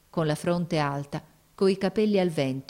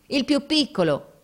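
high-pitched sailor's call; grido dei marinai; 9 semitones higher, 3 dB louder than usual. A narrator's reading. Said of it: "gridarono i marinai". (grido acuto dei marinai)

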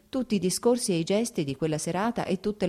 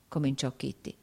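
plain reading voice

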